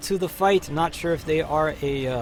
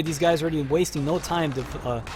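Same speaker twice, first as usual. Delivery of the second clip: normal talking voice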